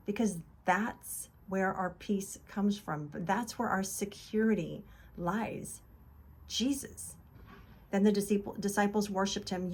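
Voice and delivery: normal talking voice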